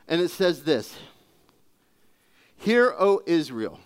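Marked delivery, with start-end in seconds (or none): 2.6-3.8 slow, weighty proclamation; scripture read with gravity; solemn scripture reading. Shown as a demonstration. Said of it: "Let me just stop right there".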